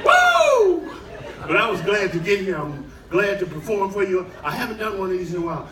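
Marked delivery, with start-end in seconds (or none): 0.1-0.9 high loud whoop of relief. Retold or imitated. Comedian celebrating getting into his room, high whoop. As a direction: let out a whoop.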